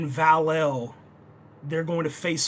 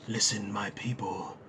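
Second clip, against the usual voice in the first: Brainiac; 10 semitones lower, 11 dB quieter than usual. Acted. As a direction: intone it deep and soft, calling for worship. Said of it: "like, calling for worship".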